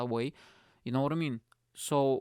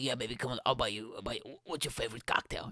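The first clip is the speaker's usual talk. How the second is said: sleazy guy; 2 semitones lower, 4 dB quieter, faster than usual. Retold or imitated. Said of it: "like a sleazy guy".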